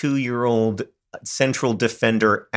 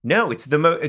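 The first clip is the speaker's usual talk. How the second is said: ordinary delivery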